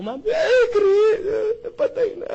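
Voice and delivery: high, wailing mock sob from the widow; crying widow. Widow to the visitor, breaking into a hammed-up sob. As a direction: imitate the weeping widow telling him he's dead. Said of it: "iyak, eh".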